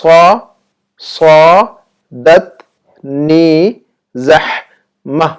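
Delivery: صادتنی زحمہ very slowly, with long pauses between syllables; drawn-out, chopped صادتنی زحمہ; syllable-by-syllable demo. Shown as a demonstration. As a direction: stretch each syllable of صادتنی زحمہ for learners to copy. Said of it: "میں بول کر نہ دکھاؤں".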